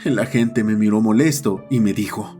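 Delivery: plain reading voice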